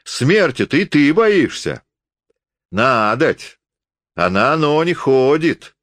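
high, teasing question; mocking. A narrator's reading. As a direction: taunt with a smirk. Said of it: "усмехнулся он". (с усмешкой поддразнивает)

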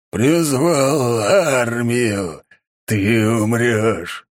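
slow, loud growling monster; deep distorted monster roar. A narrator's reading. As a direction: growl each word like a beast. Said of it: "рычал монстр". (рычание монстра)

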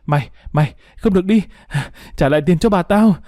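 mock yell of a desperate boy; acted screaming. A narrator's reading. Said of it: "mồm gào thét".

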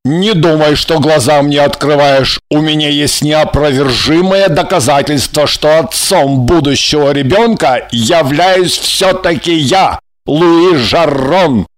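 emphatic male character voice, irritated; irritated, proud declaration; angry insistence. A narrator's reading. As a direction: assert it forcefully, weighting each point. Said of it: "сердито воскликнул он".

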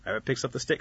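ordinary delivery, nothing marked

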